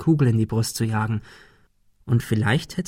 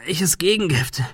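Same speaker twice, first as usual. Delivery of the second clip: strained, higher question; in pain; 6 semitones higher than usual. A narrator's reading. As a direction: ask it through pain. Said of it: "fragte er unter Schmerzen".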